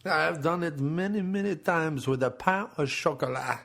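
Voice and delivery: hammed-up French accent. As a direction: confess proudly in mock French. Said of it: "Does it sound French or not?".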